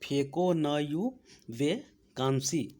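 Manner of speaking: very slow, each word stretched out; teacher repeating the full trick slowly; slow drilled mnemonic. Shown as a demonstration. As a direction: lay the memory line out slowly for the class. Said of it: "एक series clear हो गया".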